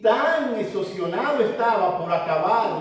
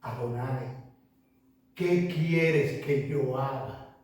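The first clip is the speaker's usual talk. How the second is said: slow, soft, hushed plea; whisper-close humble surrender to the Lord; very slow trembling prayer; prostrate, trembling; 6 semitones lower, 6 dB quieter, slower than usual. A narrator's reading. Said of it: "postrado, temblando".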